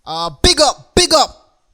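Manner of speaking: mock-Jamaican shout-out; put-on Jamaican catchphrases; Jamaican accent. Shown as a demonstration. Host runs through Jamaican slang in a mock-Jamaican accent. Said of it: "some Jamaican accents".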